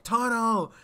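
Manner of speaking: high, loud shout of warning. Retold or imitated. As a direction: shout out the danger fast.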